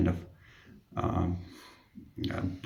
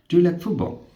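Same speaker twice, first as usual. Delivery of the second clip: high, quick imitation of the patient; mimicking the patient's African accent lightly; patient's question; 10 semitones higher, 4 dB louder than usual. Patient asking nurse mid-procedure, lightly imitated.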